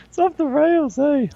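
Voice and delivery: squeaky high laugh through the words; giggling so hard it cracks high; real laughter. Real, not acted. Host cracking up at chat.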